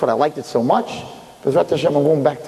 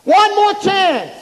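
much higher, louder, drawn-out wailing plea; desperate screaming; 4 dB louder, much slower than usual. Retold or imitated. Souls in Gehenna scream to be sent back. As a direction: beg for one more chance in agony. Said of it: "That's what they scream".

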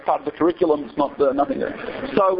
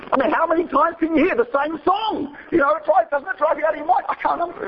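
ranting; 10 semitones higher than usual. Real, not acted. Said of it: "I'm ranting a little".

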